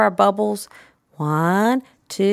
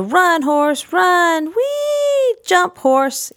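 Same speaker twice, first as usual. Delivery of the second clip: wee swooping much higher, louder play voice; sing-song run wee jump; 12 semitones higher, 3 dB louder, much slower than usual. Shown as a demonstration. Playing horse jumping the fence for a child, sing-song. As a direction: bounce it like a galloping horse. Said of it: "combine the words".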